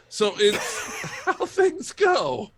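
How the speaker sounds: high, laughing through the words; real laughter. Real, not acted. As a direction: crack up while talking. Cracking up at shirtless Putin jokes.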